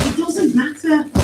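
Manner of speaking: put-on earnest young protester. A narrator's reading. Paz waves away the question, earnest protest.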